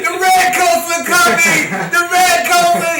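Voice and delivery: high and loud, like a town crier; alarmed shouting. Retold or imitated. Brother warning the corner, high loud shout. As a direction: sound the alarm at full voice. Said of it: "he was shouting".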